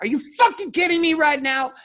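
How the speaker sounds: high, loud, outraged yell. Retold or imitated. Husband yelling at the therapist in session, outraged high yell. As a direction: yell it in disbelief. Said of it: "Like, I would yell".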